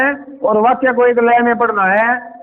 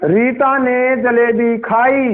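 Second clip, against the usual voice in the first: slow reading; slower than usual. Shown as a demonstration. Teacher reading slowly to show the sentence. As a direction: read slowly, word by word. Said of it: "ऐसे पढ़ना है".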